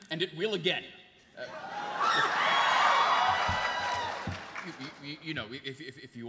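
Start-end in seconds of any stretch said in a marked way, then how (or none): 1.5-4.6 high, loud, breathy laugh; a loud high laugh that bursts out. Acted. Laugh in the scene.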